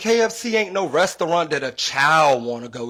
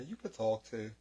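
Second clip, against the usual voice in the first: quiet aside; 3 semitones lower, 12 dB quieter than usual. Real, not acted. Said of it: "He over there trying to do damn in sign language".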